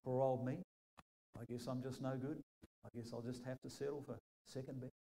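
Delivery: ordinary talk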